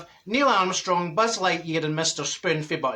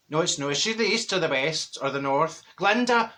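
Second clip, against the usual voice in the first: usual speaking voice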